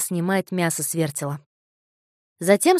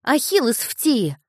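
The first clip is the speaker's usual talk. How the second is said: put-on proud boy; 5 semitones higher, 5 dB louder, slower than usual. A narrator's reading. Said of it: "ответил мальчик".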